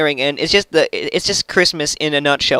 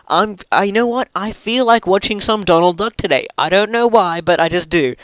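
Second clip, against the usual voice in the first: a higher, lightly put-on voice for a random Swede; imagined Swede; 4 semitones higher than usual. Imagined Swede musing to himself, in a higher, lightly put-on voice. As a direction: play a Swede idly deciding on cartoons. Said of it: "did half of Sweden just randomly think themselves".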